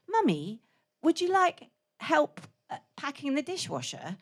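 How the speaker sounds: put-on small, soft, posh English boy's voice; careful little North London accent, softly asking; posh English child. Five-year-old son offering Mummy help, posh English child voice. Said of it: "That's how he speaks".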